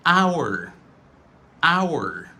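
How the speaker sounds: "Our" as ow-er; normal full pronunciation. Shown as a demonstration. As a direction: glide through two sounds in "Our". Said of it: "c'est prononciation normale".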